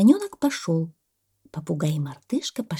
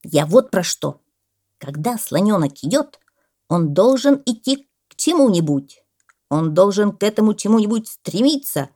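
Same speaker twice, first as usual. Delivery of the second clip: loud, emphatic, higher lecturing; insistent parrot; 4 semitones higher, 7 dB louder than usual. A narrator's reading. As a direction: drive the lesson home loudly. Parrot figure explains. (настойчивый громкий попугай)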